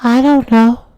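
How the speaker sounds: put-on high kid fibbing to firemen; little kid voice. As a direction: play dumb like a small child. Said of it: "looked at the firefighters and said".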